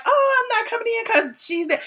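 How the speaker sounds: high-pitched mocking imitation; whiny-high mock quote. Mocks the other woman's refusal.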